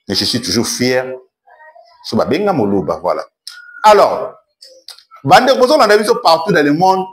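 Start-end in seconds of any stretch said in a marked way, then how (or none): 3.8-7.1 high, raised hail to the audience; calling out to viewers. Real, not acted. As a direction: call out to everyone watching, pitched up. Hails viewers all over the world. (interpeller le public d'une voix haute)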